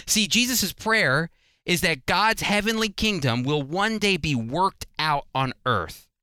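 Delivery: ordinary delivery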